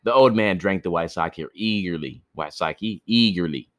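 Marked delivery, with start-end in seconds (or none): none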